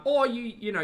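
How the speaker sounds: ordinary talk, nothing marked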